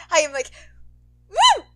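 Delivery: plain reading voice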